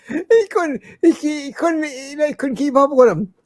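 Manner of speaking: high-pitched, squeezed out through laughter; real laughter breaking up the words; laughing while talking. Real, not acted. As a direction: tell it while cracking up. Laughing at his own story.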